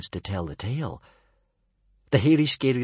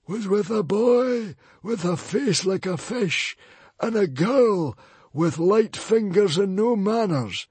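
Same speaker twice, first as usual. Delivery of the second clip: put-on high, loud librarian voice describing the boy; a scornful high-pitched librarian listing faults; sneering librarian; 12 semitones higher, 5 dB louder than usual. A narrator's reading. Librarian continues.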